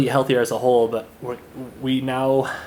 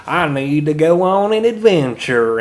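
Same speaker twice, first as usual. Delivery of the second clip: slow, louder, higher old-timey Southern drawl, like a cartoon villager; 5 semitones higher, 9 dB louder, slower than usual. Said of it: "a old-timey Southern drawl".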